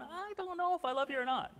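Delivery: high-pitched put-on dithering; mock unsure answer. Imagined believer unsure of loving Jesus, mock dithering. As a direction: pitch it up like a doubtful waverer.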